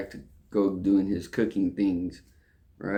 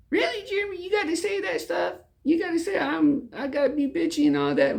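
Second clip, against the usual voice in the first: wife's complaint in a far higher put-on voice; mocking the wife; faster than usual. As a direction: whine it back way up high, mocking her. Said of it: "she was like".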